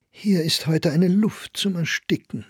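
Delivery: high-pitched, affronted complaint; annoyed young lady. A narrator's reading. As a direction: complain in a high, vexed woman's voice. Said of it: "rot vor Ärger geworden war". (hohe, verärgerte Frauenstimme)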